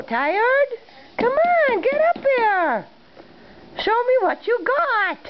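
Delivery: high excited coaxing. Real, not acted. Cheering baby on.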